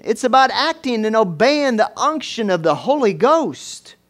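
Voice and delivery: loud, high, driving pulpit delivery; fervent preaching. Real, not acted. Preacher urging congregation to obey God, fervent and loud. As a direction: build to a forceful, emphatic peak.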